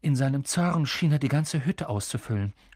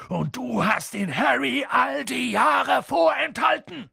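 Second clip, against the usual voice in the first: slow, high indignant shout; furious giant crying out; outraged accusation; 11 semitones higher than usual. A narrator's reading. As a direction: hurl the accusation, stretching the words.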